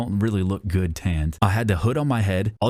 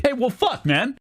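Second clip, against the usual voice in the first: high-pitched comic exasperation; exasperated. Acted. Tanned customer stung by the insult, exasperated. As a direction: burst out high in protest. Indignant answer to imagined insult.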